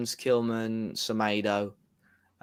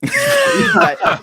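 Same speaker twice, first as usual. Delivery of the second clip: much higher and louder, a stunned yelp of a question; shocked shout; 11 dB louder than usual. Real, not acted. Friend shouting at the stream announcement in shock. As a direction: blurt it out in total disbelief. Reacting to the club's transfer announcement.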